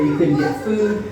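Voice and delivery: usual speaking voice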